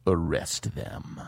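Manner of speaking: low, quiet and slow; grim. Acted. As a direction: drop it cold and grim. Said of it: "turns grim".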